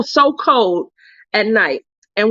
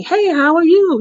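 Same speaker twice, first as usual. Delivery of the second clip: higher, lilting imitation of a sweet hello; friendly greeting; 7 semitones higher, 4 dB louder than usual. Friend reaching out before sending links, lilting greeting.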